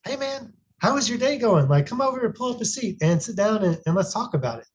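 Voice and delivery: a dad with the phone down, all ears for his son; engaged welcoming dad. Shown as a demonstration. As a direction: open the door and pull him in to talk. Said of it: "versus when you ask and I say".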